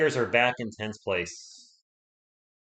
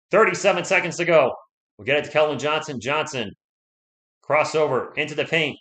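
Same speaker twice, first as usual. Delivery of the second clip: usual speaking voice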